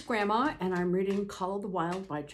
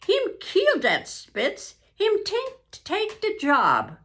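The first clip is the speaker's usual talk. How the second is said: much higher, louder gleeful exclaiming; Francois crowing over Buck, higher and louder; gleeful French-Canadian; 9 semitones higher, 6 dB louder than usual. Acted. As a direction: crow over the dog with delight. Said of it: "Francois cried".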